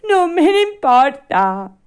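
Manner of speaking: high, loud bratty retort; Pinocchio, defiant. Acted. Puppet brushes off the fairy's warning, defiant. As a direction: throw it back stubbornly.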